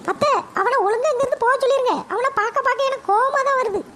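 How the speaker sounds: high-pitched mother fuming about her son; shrill-pitched scolding mum, fed up; annoyed mother. Acted. Mother complains to grandma about her son, high and irritated.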